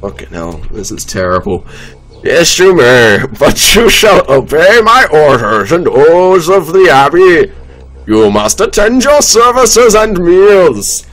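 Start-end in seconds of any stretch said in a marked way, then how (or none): 2.2-11.1 the abbot, higher and louder, laying down strict rules; strange abbot voice. Acted. The abbot sets rules for his guests, higher and commanding. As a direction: give orders like a stiff, preachy abbot. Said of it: "I don't know what the fuck's going on with his voice".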